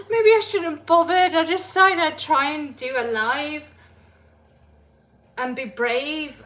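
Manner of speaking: laughing at herself for trying to be brave. Real, not acted. Streamer admitting her live stream flopped, loud laughing voice. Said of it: "It's so funny".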